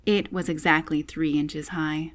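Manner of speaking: ordinary delivery, nothing marked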